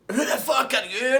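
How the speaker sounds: high, loud confrontation; angry doorstep shout. Retold or imitated. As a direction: bark it at the stranger at the door.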